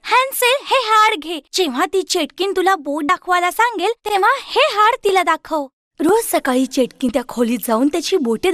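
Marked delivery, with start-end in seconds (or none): 0.0-5.7 put-on high girl's voice, urgent; Gretel's plan. Gretel telling her brother how to trick the witch, high and urgent. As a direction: pass on the secret plan.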